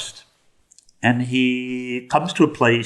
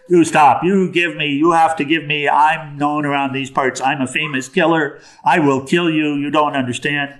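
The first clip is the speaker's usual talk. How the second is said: high, raised mock rant; hammed-up exasperated threats; upset robber; 5 semitones higher, 3 dB louder than usual. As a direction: bluster like a robber nobody takes seriously. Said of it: "Ratnaker is getting really upset now".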